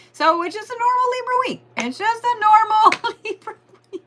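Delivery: laughing it out high and quick. Real, not acted. Amused astrologer laughing at the Libras' normal week.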